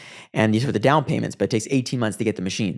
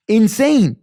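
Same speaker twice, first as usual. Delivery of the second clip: excited; 10 semitones higher, 7 dB louder than usual. Real, not acted. Climax of the story.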